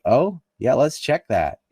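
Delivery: mock the British going along with it; sarcastic mock agreement. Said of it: "the British were like".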